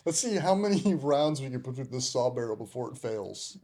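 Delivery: laughing mock grunt. Veteran imitating bored soldiers testing a gun, laughing. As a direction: giggle while playing bored troops.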